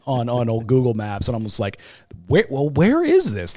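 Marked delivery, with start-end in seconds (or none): 2.3-3.6 high, loud, baffled question; puzzled questioning. Retold or imitated. Man baffled by a dropped map pin, loud puzzled question. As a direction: ask it as if utterly lost.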